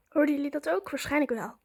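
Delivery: usual speaking voice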